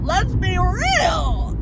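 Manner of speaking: very high, slow, stretched-out drawl; high slow sing-song. Acted.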